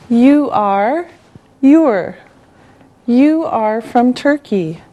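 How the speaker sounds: usual speaking voice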